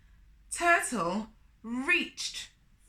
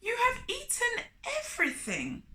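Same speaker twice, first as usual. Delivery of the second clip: much higher, crying Turtle; 13 semitones higher than usual. A narrator's reading. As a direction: wail at the empty table. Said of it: "he cried".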